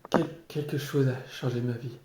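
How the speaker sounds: ordinary delivery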